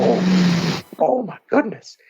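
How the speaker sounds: high, gasping mock shock; playing his twelve-year-old self, scandalised; shocked kid. A boy hearing a curse word on TV, mock shocked. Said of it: "I was like".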